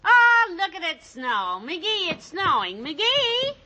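excited shout about snow. Acted. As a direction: call out thrilled at the first snowfall. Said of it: "Missus McGee looks out the window and says".

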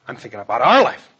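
loud, rising insistence; worked up, voice climbing; heated protest. Acted. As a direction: argue back forcefully. Heated marital quarrel.

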